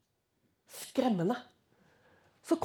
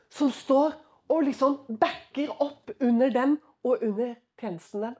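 normal talking voice

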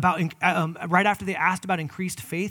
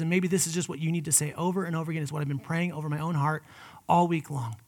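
ordinary talk

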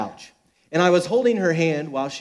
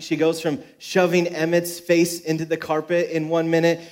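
ordinary talk

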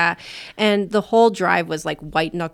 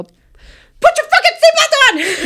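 shrieking, slow, high yell; screamed order; 10 dB louder, slower than usual. Retold or imitated. Passenger losing it at the driver over the seatbelt, shrieking yell. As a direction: explode at her like you've snapped. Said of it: "She yelled at me".

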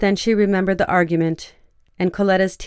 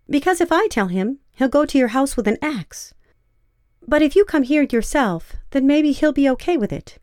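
Coletta voice; 7 semitones higher than usual. A narrator's reading.

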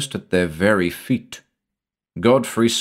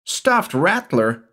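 high, drawn-out outraged echo; incredulous yell; 9 semitones higher, slower than usual. A narrator's reading. Shula answering Avilton's doubt, incredulous high-pitched yell. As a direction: throw it back in panicky disbelief. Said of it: "yelled Shula".

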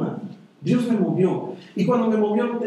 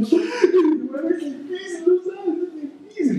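high, slow, drawn-out whimper with a laugh; hammed-up lament to anyone who asks; mock whining; 9 semitones higher, much slower than usual. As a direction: whine it like a self-pitying complainer. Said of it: "he estado lloriqueando".

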